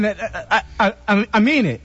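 stammering nervously; stuttering over the words. Real, not acted. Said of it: "You're stuttering".

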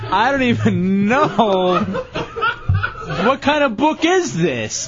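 slow, laughing through the words; can't stop laughing. Real, not acted. Host laughing at the toy.

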